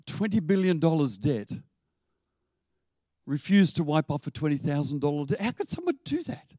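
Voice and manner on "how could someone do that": incredulous question. Acted. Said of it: "high pitched incredulity".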